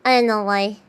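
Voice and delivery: ordinary talk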